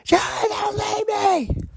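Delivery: high, strained mock scream of a desperate partner; imitated scream. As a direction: shriek it like she's begging him not to go. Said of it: "she would start screaming for me".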